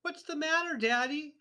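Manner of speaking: put-on high, innocent child voice; little girl. A narrator's reading. Matilda innocently teasing her father, high sweet voice. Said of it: "she said".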